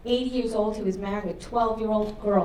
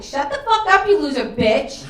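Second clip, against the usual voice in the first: losing it, yelling; 6 semitones higher, 9 dB louder than usual. Retold or imitated. Mother losing it at her whispering daughter, yelling. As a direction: explode at full volume. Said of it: "I lost my shit".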